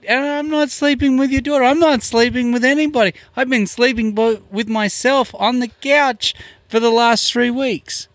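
high, strained mock protest; hammed-up panicky self-defence; frantic denial. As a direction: plead innocence with the voice way up. Acting out his protest of innocence.

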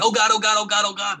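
high-pitched, rapid-fire mock panic; repeated high yelp of mild mock alarm; imitating Harley's alarm. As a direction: act out a cartoon character's oh-no moment, pitched well up. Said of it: "she looks down the elevator, she's like".

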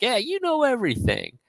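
high, mock-sarcastic retort. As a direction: needle him with fake praise. [name] mocking Bert.

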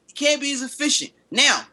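high, shouting debate; heated argument. Real, not acted. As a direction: push hard to defend your man. Heated defense of LeBron.

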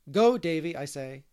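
forceful dismissal; stern command. A narrator's reading. Marilla orders Davy away.

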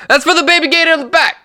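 high, fast, loud outburst; acted yelling. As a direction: yell it as the exasperated customer. Acted customer escalating into yelling.

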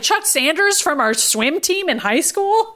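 high, slow, over-obvious question; mock exposition. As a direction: parody clunky explanatory dialogue. Mocking clumsy exposition.

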